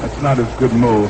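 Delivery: normal talking voice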